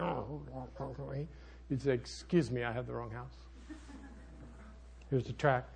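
hushed, backing-off apology; timid. Retold or imitated. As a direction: mumble it like you want to leave fast. Timid reply to growling man.

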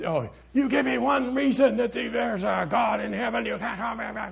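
high mocking yell of an angry debater; shouting demand. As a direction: mimic her yelling the challenge. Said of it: "but she just".

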